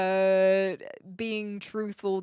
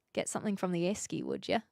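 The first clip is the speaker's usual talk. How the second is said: quick, softer Aussie mumble, lower; lazy Aussie accent; 4 semitones lower, 6 dB quieter, faster than usual. Shown as a demonstration. Streamer asking for something from esky, lazy Aussie accent. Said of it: "if I'm lazy with it".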